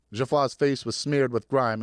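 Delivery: ordinary delivery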